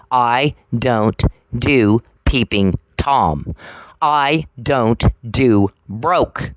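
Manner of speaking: each phrase dragged out, slow and deliberate; slow and emphatic. Real, not acted. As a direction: spell out every refusal slowly, firmly. Slow listing what she rejects.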